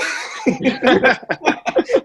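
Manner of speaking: a high, rising laugh. Real, not acted. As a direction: laugh at the absurdity. Laughs at his own story.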